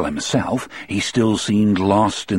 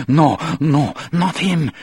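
horrified; 8 semitones higher than usual. Acted. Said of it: "in a low, horrified voice".

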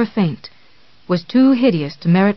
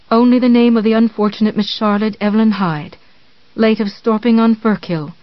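usual speaking voice

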